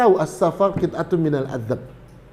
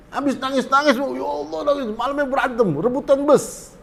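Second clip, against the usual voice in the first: exasperated retelling; 9 semitones higher than usual. Retold or imitated. Pilgrims crying then fighting.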